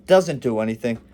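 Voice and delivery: ordinary delivery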